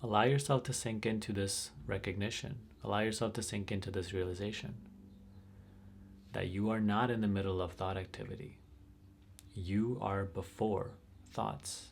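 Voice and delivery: slow, deliberate pointing with weighted key words; measured meditation teacher, long pauses; guided meditation. Real, not acted. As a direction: space out the phrases, leaning on each key word.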